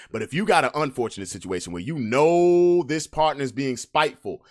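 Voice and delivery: normal talking voice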